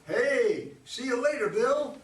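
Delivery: loud, high breezy farewell; mocking her goodbye. Teasing her about waving to Bill, loud mock breeziness. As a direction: ham up her casual goodbye.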